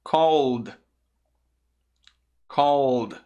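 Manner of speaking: "Called" as one falling syllable, long L and voiced D; added final D. Shown as a demonstration. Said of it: "adicionar o som do D". ("Called" com som de D vozeado no final)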